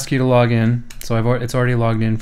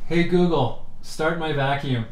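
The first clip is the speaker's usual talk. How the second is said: raised voice, talking to the speaker; calling out to voice assistant; 5 semitones higher, 4 dB quieter than usual. Real, not acted. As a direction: call out clearly to the device. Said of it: "if I yell out the door here".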